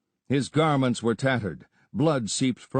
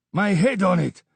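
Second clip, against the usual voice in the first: higher, loud earnest vow; swearing an oath; 8 semitones higher, 4 dB louder than usual. A narrator's reading. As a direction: swear it on your life. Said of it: "swore the Afridi".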